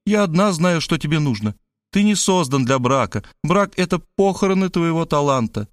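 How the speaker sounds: lightly gloating character voice; triumphant. A narrator's reading. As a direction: press the point home in triumph. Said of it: "торжествовала Мария".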